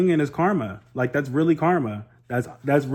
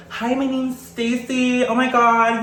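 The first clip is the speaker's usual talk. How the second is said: much higher, very slow valley girl impression; 10 semitones higher, slower than usual. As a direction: drawl it sweetly like a preppy girl named Stacy. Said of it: "Some people talk like".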